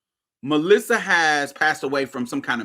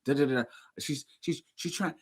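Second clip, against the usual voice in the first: soft mumbled imitation of a paranoid friend, a bit fast; lightly mock her friend's conspiratorial murmur; hint of a paranoid friend, quiet; imitating Vanessa; 5 semitones lower, 4 dB quieter than usual. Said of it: "This is how she talk".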